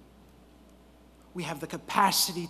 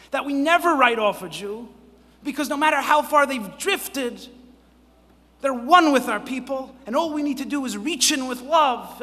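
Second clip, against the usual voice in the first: loud, higher-pitched sermon delivery; impassioned preaching; 7 semitones higher, 7 dB louder than usual. Real, not acted. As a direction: preach it with rising force and punched key words. Preaches to the audience.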